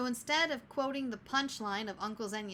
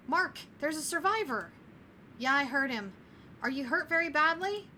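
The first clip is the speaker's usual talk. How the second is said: calling out; 5 semitones higher, 5 dB louder than usual. Acted. Rescuers calling to survivor.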